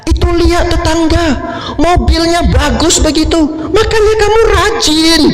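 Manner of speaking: wife nags husband over the neighbour's new car; nagging wife. Retold or imitated.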